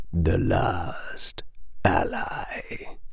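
slow, hushed, dry whisper; slow rasping whisper from the mist; sibilant whisper. A narrator's reading. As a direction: whisper it like dust from the dark. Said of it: "spoke the sibilant whisper".

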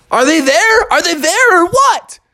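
high, loud worried pleading; injured bear asking friends about his teeth; anxious kid. Acted. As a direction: demand an answer, scared. Bubby anxiously asks about teeth.

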